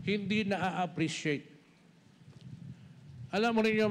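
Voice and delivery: ordinary talk, nothing marked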